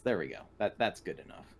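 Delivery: ordinary talk, nothing marked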